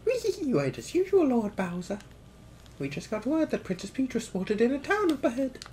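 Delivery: very high-pitched servant creature voice; high cartoon henchman reporting; cackling minion. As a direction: squeak it out like a villain's sidekick. Voices Bowser's minion in game.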